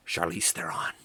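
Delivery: breathy whisper of the name; hushed, reverent whisper; awed whisper. Real, not acted. Awed reveal of the actress.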